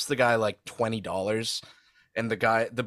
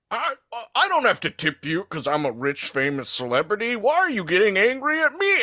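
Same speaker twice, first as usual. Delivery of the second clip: rising to a high indignant whine; mock whiny celebrity; 8 semitones higher than usual. Rob Schneider defending not tipping, mock whiny indignation. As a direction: play the wounded rich star. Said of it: "Rob Schneider like".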